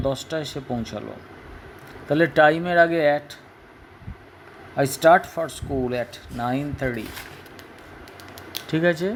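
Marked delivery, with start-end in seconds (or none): 4.8-7.1 drawn-out dictation pace; slow model sentence. Shown as a demonstration. Teacher reading an example to the class, slow. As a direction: read it slowly for pupils to copy.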